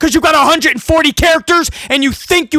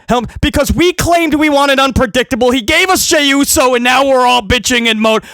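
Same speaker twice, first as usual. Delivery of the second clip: usual speaking voice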